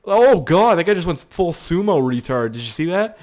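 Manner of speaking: ordinary talk, nothing marked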